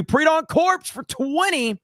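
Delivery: high, drawn-out announcer-like hail; hyped donor shout-out. Real, not acted. Streamer hailing a donor, hyped shout-out.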